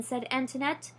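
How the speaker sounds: plain reading voice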